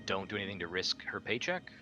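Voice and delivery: ordinary delivery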